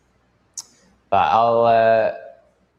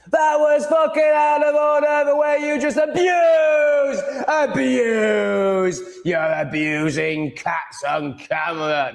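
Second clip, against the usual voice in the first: mock outraged shout; much slower than usual. Streamer mimics a commenter accusing him of abuse, high mock shout. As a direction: shriek it like a scandalised commenter.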